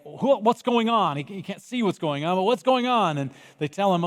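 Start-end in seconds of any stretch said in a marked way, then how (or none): 0.1-1.2 higher, louder puzzled call; a blind man asking the crowd what's happening; blind man confused. Acted. Bartimaeus asking the crowd, higher confused call. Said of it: "Bartimaeus is like". 2.4-3.2 higher, louder puzzled call; a blind man asking the crowd what's happening; blind man confused. Acted. Bartimaeus asking the crowd, higher confused call. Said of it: "Bartimaeus is like".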